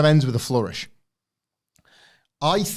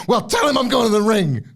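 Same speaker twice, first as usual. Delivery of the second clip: higher, louder hammed-up call; mock shout; 9 semitones higher, 6 dB louder than usual. Wrestler sending a message to his rival, a hammed-up call. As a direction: fling the line out. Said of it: "He's like".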